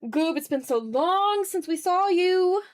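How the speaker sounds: usual speaking voice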